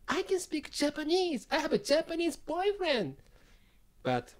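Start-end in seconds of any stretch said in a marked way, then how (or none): 0.1-3.1 very high-pitched imitated complaint; mock protesting foreigner. Voices foreigners protesting their treatment.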